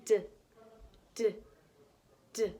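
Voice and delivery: crisp "d" sounds, one at a time with pauses. Shown as a demonstration. Teacher showing kids the deer's "d" sound, crisp and separate. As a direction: tap out "d", "d", "d" clearly. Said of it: "as we say Dee Dee sound".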